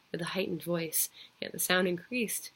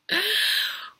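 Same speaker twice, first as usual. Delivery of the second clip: a sudden high mad laugh; gloating laugh; 14 semitones higher, 6 dB louder than usual. Acted. Killer laughing to himself over the hidden corpse, high mad laugh. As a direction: cackle with pride at the crime. Narrator gloats after hiding body.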